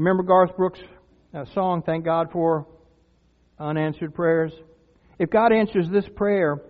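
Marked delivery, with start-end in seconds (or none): none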